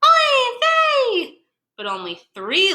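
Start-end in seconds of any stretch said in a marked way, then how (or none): 0.0-1.4 shrill high oy vey of a worried mother; cried out oy vey. Acted. Mama Gefilte crying out for her lost children, high wail. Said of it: "Mama Gefilte cried out".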